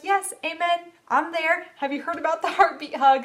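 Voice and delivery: high, eager gushing; eager agreement. Retold or imitated. Mom gushing to her cousin's wife, high and eager. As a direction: jump in with enthusiasm. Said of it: "I was like".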